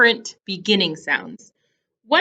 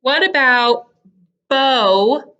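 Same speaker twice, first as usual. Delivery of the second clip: usual speaking voice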